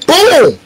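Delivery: high, punchy "boom"; imitated punch boom. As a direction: voice the blow landing, high and sudden.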